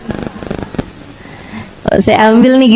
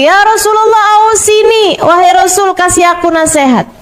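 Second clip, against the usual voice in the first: much higher, more insistent asking; young man asking again; 7 semitones higher than usual. Retold or imitated. Young man asks the Prophet again, higher and more insistent. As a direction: press the Prophet again, pitched well up. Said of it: "dia nanya lagi".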